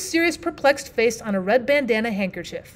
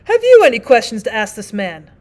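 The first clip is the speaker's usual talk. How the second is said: a touch of the stern judge, louder; 10 dB louder than usual. Acted. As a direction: judge asks the partner, lightly voiced. Judge addresses prisoner.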